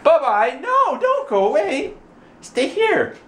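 put-on very high baby-talk plea; high mock-pleading singsong; playful pleading. Playfully calling child back.

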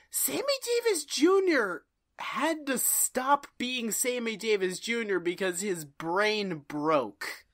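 ordinary talk

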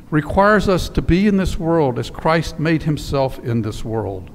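usual speaking voice

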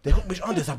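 ordinary talk, nothing marked

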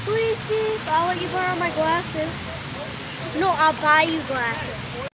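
pleading. Real, not acted. Partner pleading softly.

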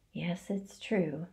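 soft weary sigh from the spider. A narrator's reading. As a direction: let the words out on a tired breath. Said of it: "sighed Charlotte".

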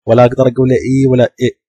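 normal talking voice

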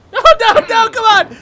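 high, very loud begging; desperate yelp; panicked pleading. Real, not acted. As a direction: plead frantically with the game. Panicking as he slips again.

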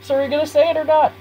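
high, quick sidekick voice; pestering underling. Acted. Underling nagging the boss to say it, eager. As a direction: press the boss eagerly for an answer.

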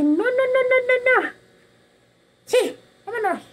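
hammed-up scared kid backing away; mock panic at the cemetery gate; frightened refusal. Boy refuses entering cemetery.

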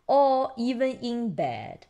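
ordinary delivery, nothing marked

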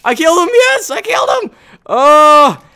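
very high ecstatic victory shriek; triumphant squeal. Real, not acted. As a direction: shriek the win, then a loud whoop. Triumph after killing the enemy.

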